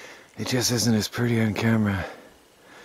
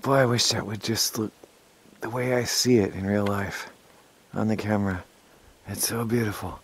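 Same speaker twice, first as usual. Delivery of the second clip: hushed; 7 dB quieter than usual. Real, not acted. Quiet awe at the snow.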